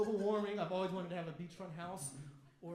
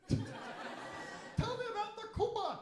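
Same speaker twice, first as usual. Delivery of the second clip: high, slow curious mock voice; mock young person; 14 semitones higher, 4 dB louder, much slower than usual. Young person who never played Mario, high slow mock question. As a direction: ask it like a kid hearing ancient history. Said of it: "I've heard of it in my history books".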